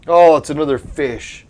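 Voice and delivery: disbelieving exclamation; surprised outburst. Real, not acted. Disappointing pet draw result.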